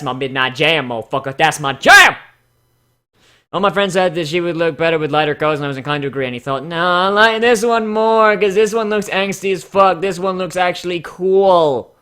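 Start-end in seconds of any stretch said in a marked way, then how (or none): 1.4-2.2 louder, punchy "That's my jam!"; proud exclamation; shouted catchphrase. Real, not acted. 6.6-11.9 higher, slow, mock defensive creator voice; a touch of a defensive artist; imitating the creator. Pony's creator voiced defending design.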